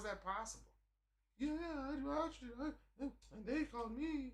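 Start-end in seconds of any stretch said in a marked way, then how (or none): 1.4-4.3 high, whimpering mock-tearful voice; mock tearful woman. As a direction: pretend to be on the verge of tears. Imitating woman's sad story.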